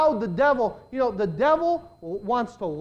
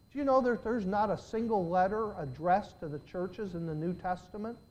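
ordinary talk

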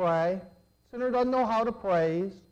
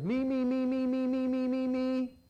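mocking selfish prayer; 4 dB quieter than usual.

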